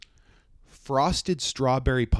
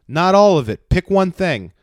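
loud, raised retold protest; higher, emphatic acted refusal; mock exasperated bargaining; 7 semitones higher, 12 dB louder, slower than usual. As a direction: imitate a man firmly setting a limit. Retold man bargaining with woman.

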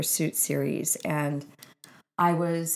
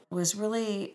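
normal talking voice

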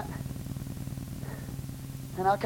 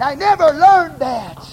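louder preaching, building to a climax; 7 semitones higher, 12 dB louder, slower than usual. Acted. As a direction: drive it home to the church.

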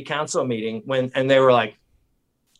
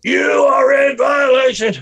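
high, drawn-out public shout; Alex Jones; 13 semitones higher, 7 dB louder than usual. Retold or imitated. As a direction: bellow an accusation at the building. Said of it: "I was Alex Jonesing outside the building".

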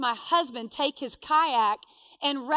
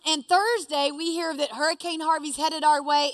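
ordinary delivery

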